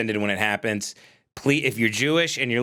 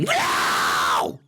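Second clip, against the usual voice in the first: screamed no; 9 semitones lower than usual. Pretend. Him bellowing no at the questioner. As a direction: bellow it back. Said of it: "I'm just gonna start screaming".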